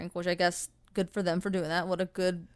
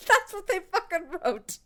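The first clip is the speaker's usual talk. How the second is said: higher, laughing through it; 9 semitones higher than usual. Real, not acted. Host laughing as she repeats the review line. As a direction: laugh out the punchline.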